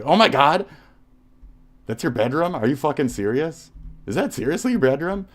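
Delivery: loud, high gasp of disbelief; can't believe what he sees, shouting; shocked disbelief. Real, not acted. Finding the critic's bedroom photo online, loud shocked disbelief.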